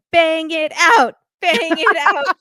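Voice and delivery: loud high cheer of bang it out; egging them on loudly, drawn out. Retold or imitated. Host yelling at the screen, egging the couple on. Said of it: "So I was like".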